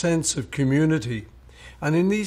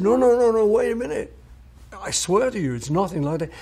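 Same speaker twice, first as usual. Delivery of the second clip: urgent denial; 7 semitones higher than usual. Retold or imitated. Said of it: "I said".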